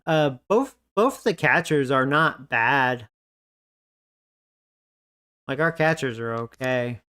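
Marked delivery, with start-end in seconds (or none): none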